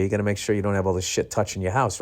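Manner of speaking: usual speaking voice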